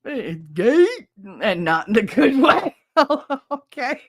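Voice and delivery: loud laughing reaction; cracking up while reading a chat joke. Real, not acted. Streamer cracks up at a viewer's joke, laughing loudly.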